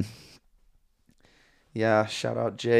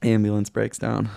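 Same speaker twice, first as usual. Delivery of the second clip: ordinary delivery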